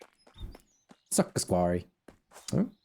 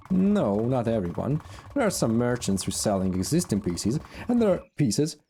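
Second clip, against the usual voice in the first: raised voice of a haughty crew boss; 3 semitones higher than usual. Acted. Voicing sister NPC.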